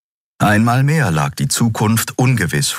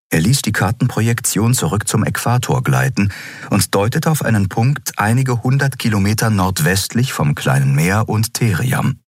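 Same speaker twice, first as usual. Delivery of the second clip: ordinary delivery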